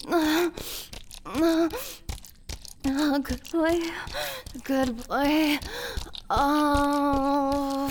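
high, moaning cries between words; urgent, drawn-out and overwhelmed; passionate climax. Acted. Princess urging on her lover, high urgent moans.

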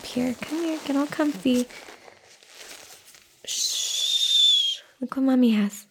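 put-on soft, sing-song baby talk to an infant; soothing a child. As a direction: coo gently like a mother changing her baby. Mother baby-talking while changing an infant.

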